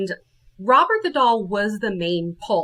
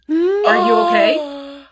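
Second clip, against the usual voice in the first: loud, high, drawn-out cry of concern; alarmed concern; 8 semitones higher, 7 dB louder, slower than usual. Retold or imitated. Asking a friend who walked through a ghost, alarmed. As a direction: stretch it out in raised alarm.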